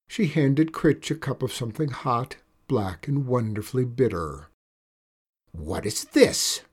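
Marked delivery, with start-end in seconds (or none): 5.5-6.6 low, slow witch voice demanding an answer; a lightly voiced grumpy witch insisting. A narrator's reading. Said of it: "demanded Critch".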